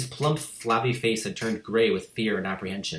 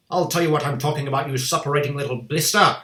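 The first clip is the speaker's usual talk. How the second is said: fast, loud angry shout; 5 semitones higher, 6 dB louder than usual. A narrator's reading. Headmistress shouting at the boy on stage, angry. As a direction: fire it at him. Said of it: "the Trunchbull shouted".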